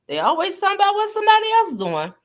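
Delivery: high, fast mocking voice of the gossipers. Mocking the gossipers, a high fast mimic voice. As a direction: squeak it out fast like nosy gossips.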